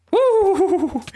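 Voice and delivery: excited whoop. Real, not acted. Cheering mid boss fight.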